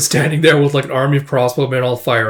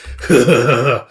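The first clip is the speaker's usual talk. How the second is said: short acted rumbling laugh; monster chuckle. Monster chuckles before speaking.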